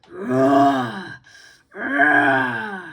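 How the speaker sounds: loud snarling growl; loud attacking growls; dog growl. Acted. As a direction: snarl and lunge.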